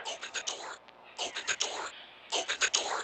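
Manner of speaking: starts very soft, grows to full level; whispered command, building. Acted. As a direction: press him harder each time to open it. Creature urging repeatedly.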